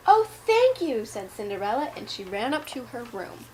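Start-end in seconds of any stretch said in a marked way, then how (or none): 0.1-1.0 delighted thanks. Acted. Cinderella's delighted reply.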